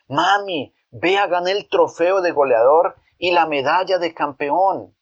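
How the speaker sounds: put-on a child running in with his trophy; joyful. Boy shows his mother his trophy and medal, joyful. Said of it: "le dijo alegre".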